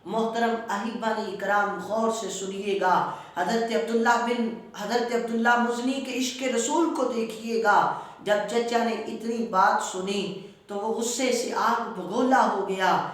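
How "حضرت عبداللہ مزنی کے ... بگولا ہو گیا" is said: ordinary delivery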